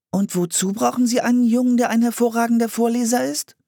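put-on very high little-girl voice; curious girl. A narrator's reading. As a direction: ask in a high, inquisitive child's voice. Fragte Anne den Mann.

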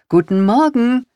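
high, loud, cheery greeting; cheerful call. A narrator's reading. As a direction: call it out brightly and happily. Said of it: "rief sie fröhlich".

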